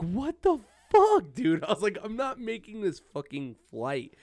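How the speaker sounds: high, loud, strained exasperation; mock panic. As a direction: cry out like the flight is lost. Retold panic at the security line.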